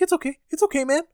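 high mock-soothing "it's okay"; mock soothing Tommy. Imitating Tommy talking to the stolen baby, high and mock-soothing. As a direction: coo at the baby in a high voice, half mocking. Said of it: "He's like".

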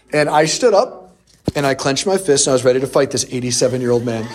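normal talking voice

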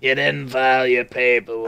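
lightly put-on creepy character voice; character impression. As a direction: scold as a creepy film character. Acting out a film character quote.

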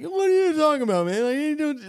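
very high, loud mock protest; Jeremy. Teller demonstrates how his buddy talks, high-pitched imitation. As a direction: put on the buddy's squeaky, indignant denial. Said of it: "like, higher pitch".

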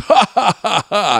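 high, hammy villain laugh; evil chuckle. Retold or imitated. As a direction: cackle like a movie bad guy.

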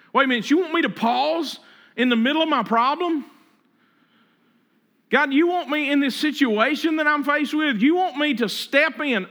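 wait, you want me to do what?; incredulous protest. Retold or imitated. Believer protesting to God, incredulous and pleading. Congregation voiced objecting to God.

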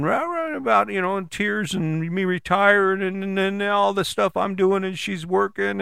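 high, droning mock complaint; imitated tearful rant. Husband mimics upset wife listing grievances, mock ranting. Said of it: "And she started in".

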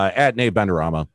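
usual speaking voice

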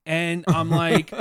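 usual speaking voice

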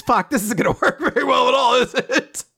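high voice cracking into laughter; laughing mock frustration. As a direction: act exasperated while laughing through it. Acted frustration breaking into laughter.

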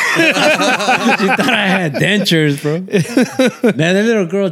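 can barely get it out for laughing. Real, not acted. Telling friends how the kid roasted him, laughing slowly.

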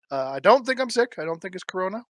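usual speaking voice

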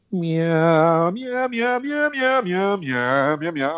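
high, sustained brassy "myah" pulses; imitated horn line. Drummer mimicking the horn line coming in, imitated horn blasts. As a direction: sing out the horns as repeated rising meows. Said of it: "you hear the horn line come in".

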